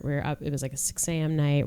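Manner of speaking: usual speaking voice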